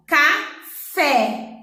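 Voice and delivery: "café" split in two, the final "fé" set apart; stressed last syllable. Shown as a demonstration. Teacher illustrates oxítona, "café" stressed on the end. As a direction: break "café" into syllables, landing firmly on "fé". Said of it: "a sílaba que nós falamos mais alto".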